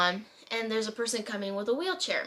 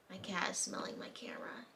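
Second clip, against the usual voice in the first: whispered aside to the viewers; 13 dB quieter than usual. Real, not acted. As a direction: drop to a whisper about the cat. Aside about cat, whispered.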